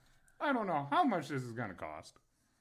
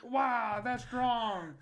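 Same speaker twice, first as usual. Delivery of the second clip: impressed masseuse mimicry; 11 semitones higher, 4 dB louder, much slower than usual.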